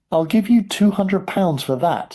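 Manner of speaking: loud. A narrator's reading. Bidder in crowd.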